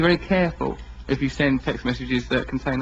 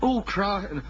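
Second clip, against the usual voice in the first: northern parent; 4 semitones higher, slower than usual. Retold or imitated. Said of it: "do your parents say".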